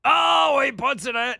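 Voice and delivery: loud, high sportscaster shout; Dave Johnson and Mike Breen. Acted. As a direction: belt it like a big play-by-play call. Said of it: "somewhere between Dave and Mike Breen".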